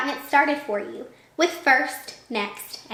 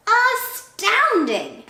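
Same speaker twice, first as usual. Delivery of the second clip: high, very drawn-out astonishment; acted amazement; 9 semitones higher, 3 dB louder, much slower than usual. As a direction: stretch the word out in wonder. Gruffalo amazed at the mouse.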